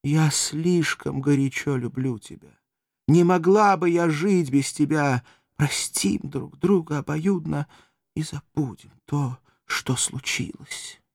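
slow, languid, fading to a whisper. Acted. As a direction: melt into a languid confession. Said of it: "продолжала томным голосом".

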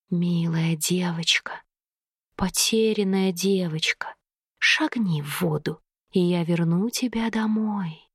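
low, slow hissing whisper; snake woman voice. A narrator's reading. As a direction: breathe each word out like a serpent. River creature lures the girl.

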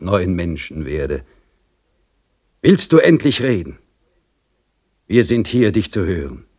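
stern priest demanding a confession; admonishing priest. Acted. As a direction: press him to confess with pious severity. Priest urges Iwan to confess.

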